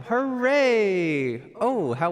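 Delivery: loud, high hooray, drawn out; cheer. Real, not acted. Pianist cheering that it's piano time, loud high hooray. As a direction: throw a big happy cheer to chat.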